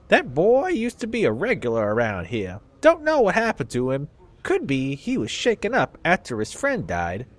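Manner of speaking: put-on southern accent. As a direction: play a crusty old Southern dustman in a Southern accent. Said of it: "give old people southern accents".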